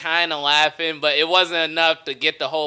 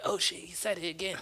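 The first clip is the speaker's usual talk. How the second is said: muttered aside; 4 semitones higher, 13 dB quieter than usual. Retold or imitated. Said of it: "I heard a table go like".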